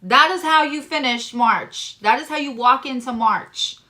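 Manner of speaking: loud, punchy proclamation; emphatic declaration. Real, not acted. Reader proclaiming the month's outcome, loudly. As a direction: announce it with full force.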